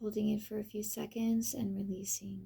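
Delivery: soft, unhurried meditation voice with long pauses; guided meditation. Acted. Reading a pathworking exercise to the listener, guided meditation. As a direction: gently lead the listener through the enchanted forest.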